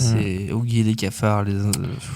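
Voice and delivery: low, soft, sighing dejection; deflated. Acted. Host dejected after the wrong theme tune, low and deflated. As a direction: let it sag, low and weary.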